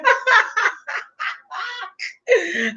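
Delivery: long high-pitched cackle; high gloating laugh. Real, not acted. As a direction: burst into a drawn-out high laugh. Cracks up at own point.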